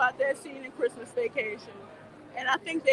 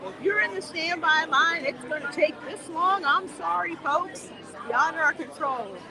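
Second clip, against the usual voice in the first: lightly voiced park announcer, pitched up; imagined PA announcement; 4 semitones higher than usual. Vlogger imitating staff addressing the standby line, mock announcement. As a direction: pitch it up a little like a mock PA apology. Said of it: "get over the P A system and say".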